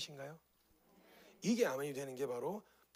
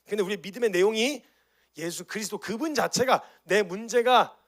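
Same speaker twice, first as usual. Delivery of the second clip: loud, high fervent preaching; preaching at full force; 5 semitones higher, 11 dB louder than usual. Real, not acted. Preacher urging the church about faith, fervent. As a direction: drive the point home to the church.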